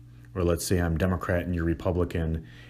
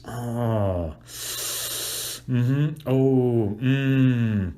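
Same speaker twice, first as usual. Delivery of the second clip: higher comforting hums; empathetic sound effects; 4 semitones higher, much slower than usual. Shown as a demonstration. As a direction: murmur understanding noises. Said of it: "You use sound effects".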